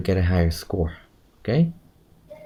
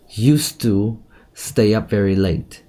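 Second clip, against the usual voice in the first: "used" punched, the line said slowly; stress on used; slower than usual. Shown as a demonstration. Teacher demonstrating the stress on "used". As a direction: hit "used" hard. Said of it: "stress on used".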